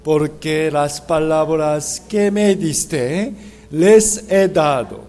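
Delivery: slow group reading. Shown as a demonstration. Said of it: "lentamente leamos juntos".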